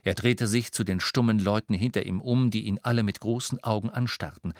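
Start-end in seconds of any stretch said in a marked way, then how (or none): none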